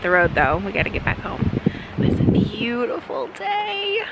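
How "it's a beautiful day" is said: high, drawn-out singsong call; sing-song exclamation. Real, not acted. Vlogger delighting in the day outdoors, high sing-song. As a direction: stretch it out high and lilting. Vlogger calls out a cheery sign-off.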